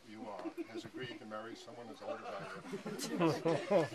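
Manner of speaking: breaking into laughter mid-vow; laughing through words. Real, not acted. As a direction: laugh while reading the line.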